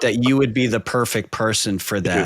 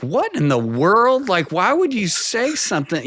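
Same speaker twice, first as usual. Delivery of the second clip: incredulous wife; 9 semitones higher than usual. Retold or imitated. Wife scolds him with a look.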